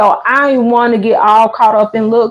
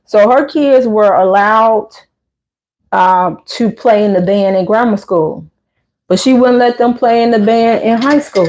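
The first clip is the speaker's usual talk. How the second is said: ordinary delivery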